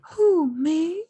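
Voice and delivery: a light high coy Marilyn; Marilyn Monroe. Retold or imitated. Hosts riff on Marilyn, lightly voiced coy starlet. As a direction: play innocent like a screen siren. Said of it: "I'm not very good at her".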